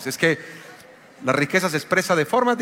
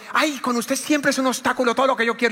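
higher, complaining Ay; whiny wife; 7 semitones higher than usual. Retold or imitated. Pastor imitating his wife's complaint in their quarrel, higher and whiny. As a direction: mimic a nagging wife, whiny.

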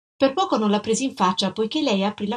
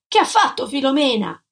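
raised, insistent demand; shouted question; 5 semitones higher, 4 dB louder than usual. A narrator's reading. Husband demanding to know about his wife, raised voice. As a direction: press loudly for an answer.

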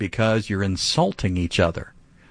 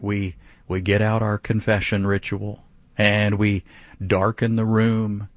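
ordinary talk, nothing marked

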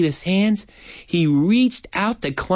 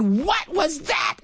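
very high, drawn-out shriek of a question; acted squawk of shock; shocked demand; 12 semitones higher, slower than usual. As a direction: stretch each word in high-pitched outrage. Startled aunt demands an explanation.

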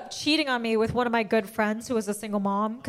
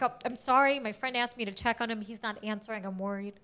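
polite worried murmur; 3 semitones lower, 10 dB quieter than usual. Retold or imitated. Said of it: "I'm like".

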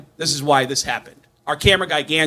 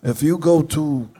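lightly voiced, deliberate rock-star impression; measured, self-important mock pitch. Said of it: "And [name] came in".

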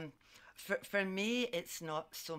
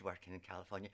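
weary dread; 8 semitones lower, 8 dB quieter, faster than usual. Real, not acted. Recalling her California job, with mock dread. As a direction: drop it low like a heavy sigh.

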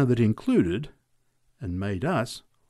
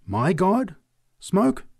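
indignant cry; 2 semitones higher, 4 dB louder, slower than usual. A narrator's reading. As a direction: recoil at sacrilege, then deny it firmly. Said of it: "drew herself indignantly erect".

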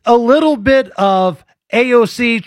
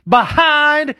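high mock Southern "behind"; fake Southern twang; 6 semitones higher than usual. Host mocks the politician's wrong word, fake Southern twang. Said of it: "the word is behind".

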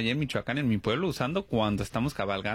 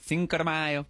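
put-on American accent; slower than usual. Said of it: "los americanos el rollo del".

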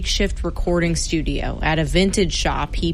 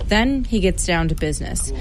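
usual speaking voice

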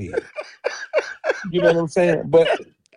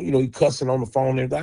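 ordinary delivery

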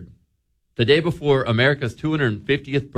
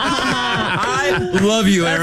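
laughing; 7 semitones higher, much slower than usual. Real, not acted. Laughing through a fond message.

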